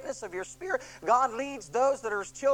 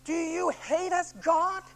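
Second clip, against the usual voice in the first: slow, higher anguished plea to God; crying out; 7 semitones higher, 3 dB louder, slower than usual. Retold or imitated. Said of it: "the Israelites cried out".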